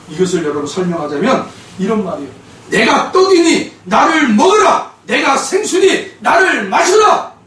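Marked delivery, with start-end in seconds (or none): 2.7-7.3 slow, loud, high proclamation of Christ's words; proclaiming Jesus. Acted. Preacher voicing Jesus to his congregation, slow loud proclamation. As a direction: thunder it out slowly from the pulpit.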